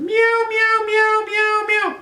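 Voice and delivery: very high pleading meows; meowing. Acted. Narrator answers the crying cat with high pleading meows. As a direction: mew like a lost lonely cat. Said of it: "until I said".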